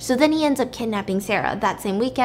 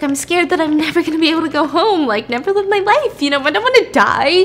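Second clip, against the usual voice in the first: high, loud acted panic; freaking out; 6 semitones higher, 5 dB louder than usual. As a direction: voice climbing into retold hysteria. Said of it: "She's freaking out".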